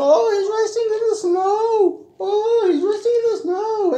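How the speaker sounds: very high, singsong coo. Acted. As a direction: coo gently to the little one. Singsong comment reading to child.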